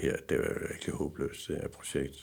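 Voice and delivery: hushed, almost voiceless aside; whispered thought. Retold or imitated. Driver thinking the car project is hopeless, whispered. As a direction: whisper it to yourself in despair.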